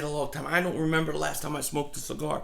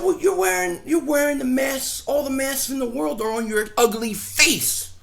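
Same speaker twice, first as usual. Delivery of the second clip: louder and higher, exasperated; retold angry retort; 6 semitones higher, 4 dB louder than usual. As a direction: fire back at the nosy neighbor. Retold shouting at HOA lady.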